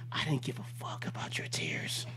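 low, whispered dismissal; hushed aside. Real, not acted. Cop addressing the young lady he ticketed, hushed dismissal. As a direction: drop to a cold whisper.

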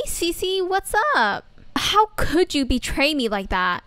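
ordinary talk

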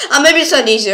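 loud laughing admission. Real, not acted. Laughs at own puzzle.

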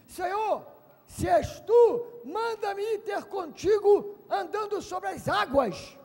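high, loud call; bold request. Acted. Peter calling to Jesus on the water, bold cry. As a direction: call boldly across the water. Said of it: "Pedro disse".

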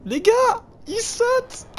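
very high, loud squeal; shrieking excitedly. Real, not acted. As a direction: squeal with panicked delight. Shrieking at jumping eel.

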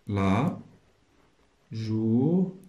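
long held syllables with pauses between; syllable-by-syllable dictation. Shown as a demonstration. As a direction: dictate it very slowly, leaving gaps to write. (إملاء مقطّع بشوية)

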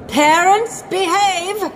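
high-pitched scolding call; shouting headmistress. A narrator's reading. Missus Oddbod to squabbling parents, shouting. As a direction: call the unruly grown-ups to order, pitched high. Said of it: "shouted Missus Oddbod".

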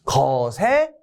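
very slow, spaced-out syllables; stretched-out mnemonic; memorization cue. Shown as a demonstration. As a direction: drill it slowly for students to memorize. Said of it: "이렇게 여러분 암기하시면".

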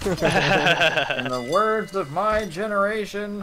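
high, loud, drawn-out mock proclamation; mock-grand retort. Joking with friends in words of their generation, mock-grand.

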